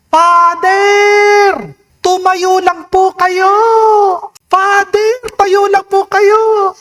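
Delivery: very high, drawn-out call; imitated shouting. Companions call to the fallen priest, imitated high shout. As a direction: call out slowly as if from far above. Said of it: "Sumisigaw sila, sabi nila".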